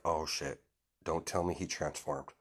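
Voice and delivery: low, soft realisation; hushed dread. Acted. Kagura realising he transformed, hushed and low. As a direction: mutter it low as it dawns on you.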